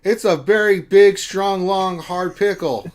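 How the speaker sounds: loud, high, slow mock bragging; hammed-up boast. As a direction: brag it up big for the laugh. Boasting about his pickle.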